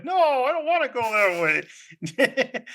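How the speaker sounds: high, drawn-out, half-laughing protest; mock whining refusal. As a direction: drag it out like a reluctant kid. Acts out refusing the walk.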